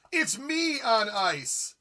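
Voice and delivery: grand ice-show announcement; show announcer. Pretend. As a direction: proclaim it like a marquee. Announcing again, slower.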